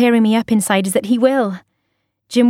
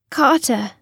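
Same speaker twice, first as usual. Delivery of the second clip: forlorn; 4 semitones higher than usual. A narrator's reading.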